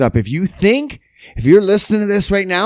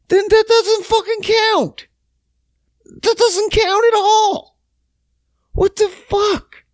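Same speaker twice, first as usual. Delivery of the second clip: much higher, strained mock-outraged yell; mock outrage. Boyfriend outraged at girlfriend's TV talk, high mock outrage. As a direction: squeal it in hammed-up disbelief. Retelling his outburst at girlfriend.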